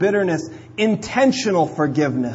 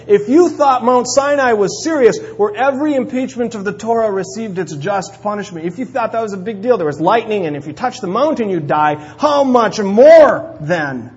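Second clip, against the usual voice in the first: preaching at full force; 4 semitones higher, 6 dB louder than usual. Acted. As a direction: drive it home like a preacher. Preaching builds to climax.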